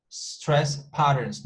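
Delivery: "stress patterns" said slowly, with each word leaned on. Shown as a demonstration. Teacher slowly corrects herself: stress patterns. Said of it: "not accent patterns".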